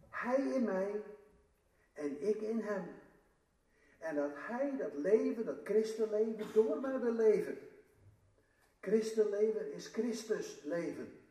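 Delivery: luider en vuriger preken; krachtig preken. Acted. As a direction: verkondig het met kracht aan de gemeente. Preacher rises to full force. (luider en vurig preken)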